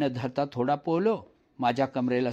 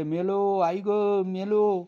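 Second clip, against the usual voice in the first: drawn-out, slow scared cry for mother; panicked cry; 6 semitones higher, 3 dB louder, slower than usual. Acted. Boy pushed into the well crying for his mother, slow scared cry. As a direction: cry out as if drowning. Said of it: "मी ओरडले".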